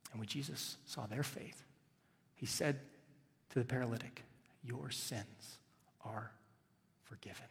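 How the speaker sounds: near-whisper solemn pronouncement; hushed reverence. Acted. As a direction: let the words fall hushed and holy. Solemn quiet delivery of Jesus' words.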